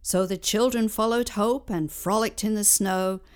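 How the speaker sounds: ordinary delivery, nothing marked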